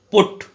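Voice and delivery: पुट said loud with a short u; teacher demonstrating a vowel; short u sound. Shown as a demonstration. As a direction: punch the u in पुट. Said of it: "पुट की आवाज़ ये है".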